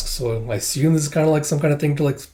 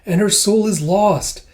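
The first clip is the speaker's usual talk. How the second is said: high, strained little voice, louder; pleading like an anxious small creature; Kippa's voice; 7 semitones higher, 4 dB louder than usual. Retold or imitated. Kippa begging Master Ren to help Miss, high pleading voice. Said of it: "It's like".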